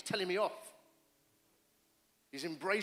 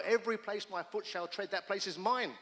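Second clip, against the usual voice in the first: louder, higher declaration of the promise; preaching at full force; proclaiming scripture; 4 semitones higher, 5 dB louder than usual. Real, not acted. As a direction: proclaim the verse with conviction. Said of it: "is what God told me".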